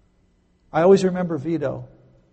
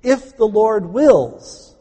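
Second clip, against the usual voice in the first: "If the Lord wills" slow, high and loud; stressed condition; 6 semitones higher, 7 dB louder, much slower than usual. Shown as a demonstration. Teaching the conditional, slow emphatic. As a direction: lean hard on "If the Lord wills" slowly. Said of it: "This is a conditional sentence".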